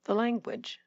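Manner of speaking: "language" stressed on the first syllable, short weak ending; softly, with a quick reduced last syllable on "language"; standard unstressed ending. Shown as a demonstration. Coach models North American accent, "language" with unstressed ending. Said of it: "I'll say it with a standard North American".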